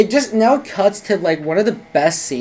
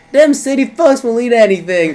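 higher mock-Southern country guy; Southern accent; 5 semitones higher, 4 dB louder than usual. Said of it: "have these, like, southern accents".

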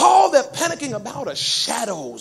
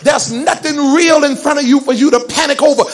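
preaching that builds to a loud push; driving preaching; 5 semitones higher, 10 dB louder than usual. Real, not acted. Preacher tells worriers the devil fights in the mind, driving preaching. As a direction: press each clause on the congregation, gathering force.

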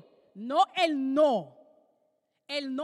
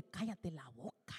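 hushed; 11 semitones lower, 18 dB quieter than usual. Acted. Father tells Peter shut up.

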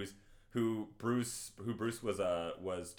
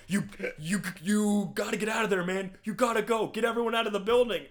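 high, loud, stammering mock panic; urgent warning; 10 semitones higher, 6 dB louder than usual. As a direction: act out a frantic evacuation order. Retold hero urging friend to evacuate.

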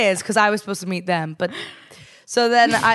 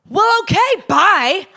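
curt goodbye; 12 semitones higher, 7 dB louder, slower than usual. Retold or imitated. Storming off from parents.

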